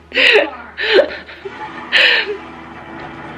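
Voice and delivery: squealing high laugh; high breathless laugh. Real, not acted. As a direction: let out a high, winded laugh. After the hard silks class.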